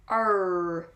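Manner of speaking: "R" held long and loud with rounded lips; very clear R. Shown as a demonstration. Teacher showing learners lip rounding, very clear American "R". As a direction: round the lips and sound a full R in "R". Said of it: "we pronounce that R very clearly".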